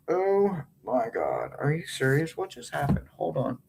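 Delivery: hushed disbelief. Real, not acted. Discovers the split card, softly.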